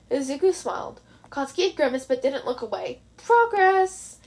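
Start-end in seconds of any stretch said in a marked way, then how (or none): 3.2-4.2 higher, louder little cheer; excited exclamation; triumphant. Acted. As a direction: celebrate a small win. Small inner victory.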